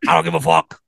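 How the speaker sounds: lightly put-on comedian's mock threat. Comedian as manager threatening [name], light impression. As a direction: play the comedian brushing off objections. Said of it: "[name] to fucking play".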